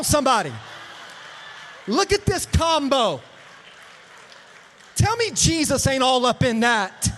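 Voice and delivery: loud, high preacher's hollering; full-force preaching. Real, not acted. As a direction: whip the crowd up in joyful shouts.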